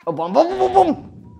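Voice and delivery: rapid-fire loud, high yelps; startled alarm. Real, not acted. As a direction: blurt it out as the bomb appears. Startled by a bomb in game.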